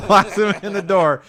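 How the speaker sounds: laughing. Real, not acted. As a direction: blurt it out while cracking up. Laughing reveal of punchline.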